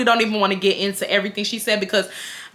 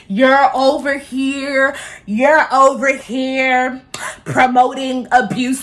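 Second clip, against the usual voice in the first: mocking commenters; much slower than usual. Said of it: "some people finna say".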